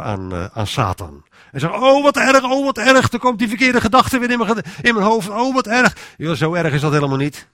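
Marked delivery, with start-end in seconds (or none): 1.8-6.1 high, loud, hammed-up alarm; exaggerated "oh, wat erg" wail, pitched way up; mock panic. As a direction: play the fretful believer in a mock fluster. Mocking an overly alarmed believer.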